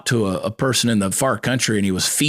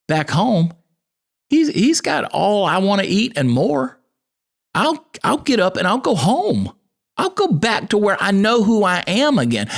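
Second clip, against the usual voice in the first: higher, earnest inner voice of the lost son; prodigal son coming to his senses; 7 semitones higher than usual. Retold or imitated. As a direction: voice him realizing he must go home. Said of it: "he's like".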